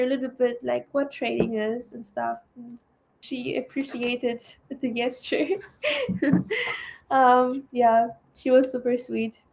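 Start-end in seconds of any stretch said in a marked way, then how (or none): 5.8-6.8 a short, high real laugh. Real, not acted. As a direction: laugh it off lightly. Laughing at own story.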